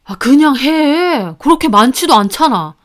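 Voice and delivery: irritated, argumentative husband voice; nagging husband. A narrator's reading. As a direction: push back impatiently. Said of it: "남편도 저에게 따지더군요". (따지는 남편 목소리)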